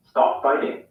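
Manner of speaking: American "Stop fighting" with a tapped T in "fighting"; American accent. Shown as a demonstration. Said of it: "In the U S".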